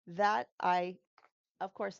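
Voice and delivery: usual speaking voice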